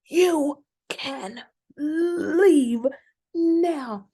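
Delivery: imitated indignant, near-crying order to leave; real indignation. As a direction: draw it out, hammed-up outrage. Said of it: "it's with real indignation".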